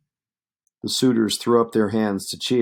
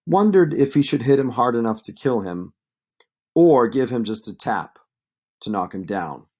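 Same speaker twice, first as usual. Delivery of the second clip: ordinary delivery, nothing marked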